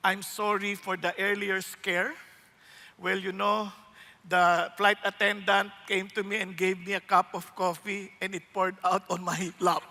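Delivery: airline captain. Retold or imitated. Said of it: "Tapos sabi nung kapitan".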